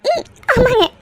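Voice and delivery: high, tearful happy voice; crying. Acted. Mother denying she cries at daughter's return, tearful. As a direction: insist you are fine through happy tears. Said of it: "அப்புறம் எதுக்கு இப்ப நீ அழற".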